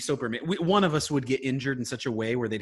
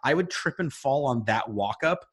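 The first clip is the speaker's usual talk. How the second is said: ordinary talk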